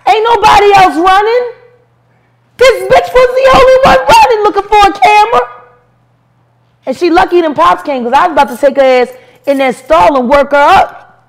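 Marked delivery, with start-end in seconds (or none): 0.1-5.4 higher, louder, fast indignant telling; worked-up shouting story; excited rant. Real, not acted. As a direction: build the outrage, pushing fast. Ranting about Paris running.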